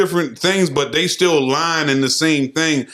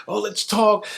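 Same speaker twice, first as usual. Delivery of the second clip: mock soft pleading; 4 semitones higher, 4 dB quieter, slower than usual. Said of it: "you wanna be in that".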